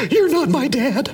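high, put-on pouting child; sulky kid voice. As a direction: toss it off like an annoyed kid. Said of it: "they're like".

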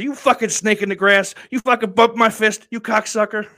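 loud, high, hammed-up rage at a traitor; buddy jokingly shouting about the fist bump, mock angry; mock fury. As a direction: play the betrayed friend, yelling in jest. Retold mock fury at a betrayal.

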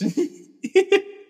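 high burst of laughter; laughing. Real, not acted. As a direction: crack up mid-sentence.

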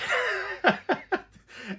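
loud high cackle, cracking up; burst of laughter. Real, not acted. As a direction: break into a big real laugh at the silly name. Laughing at a ridiculous name.

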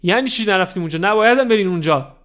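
loud, high furious scolding; angry scolding. Acted. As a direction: yell at the kid in a rage.

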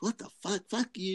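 high, shocked what the fuck; outraged disbelief. Retold or imitated. Buddy outraged at price.

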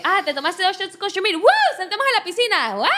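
rowdy party cheering. Retold or imitated. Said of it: "La gente como que".

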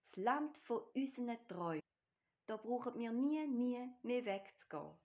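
high, loud happy declaration; delighted enthusiasm. A narrator's reading. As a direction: rave about your dream home. Tiger delighted with dream land. (begeistert, hoch und laut schwärmend)

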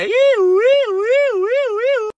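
ulular agudo de patrulla; aúlla como sirena, alargando cada iu; sirena imitada. Retold or imitated. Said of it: "A las sirenas tenían prendidas". (sirena de patrulla hecha con la boca)

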